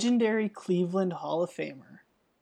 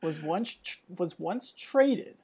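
ordinary talk, nothing marked

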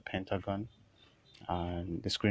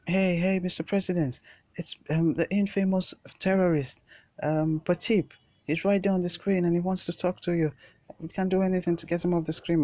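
high, loud alarmed report; panicked secretary; 10 semitones higher, 6 dB louder than usual. Acted. Secretary warning the president about Patip, high loud panic. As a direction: raise the alarm, pitched way up. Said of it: "he'll be like".

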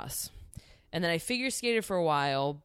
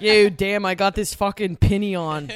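loud Philly sports fan rant; Philly accent; 10 dB louder than usual. Pretend. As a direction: yell it like a rowdy Eagles fan. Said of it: "my New Jersey accent is just a Philly accent".

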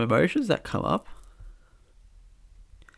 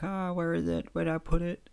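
worried; 4 semitones higher than usual. Retold or imitated. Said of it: "you were worried".

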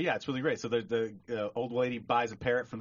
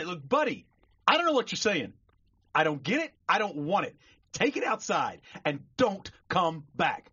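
raised, higher, emphatic scolding; fed-up shopkeeper; 7 semitones higher, 4 dB louder than usual. Acted. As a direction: lay down the law to a nuisance customer. Shopkeeper banishes customer in joke.

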